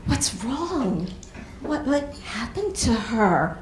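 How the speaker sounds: hushed, slow pitying gasp; mock a stranger's hushed pity. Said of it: "and they go".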